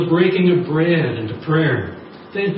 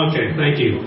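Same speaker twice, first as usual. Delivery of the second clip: usual speaking voice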